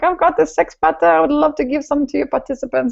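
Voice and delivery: high, lightly mimicked Bonnie; lightly voiced eager friend; voicing Bonnie. Host voices Bonnie's offer.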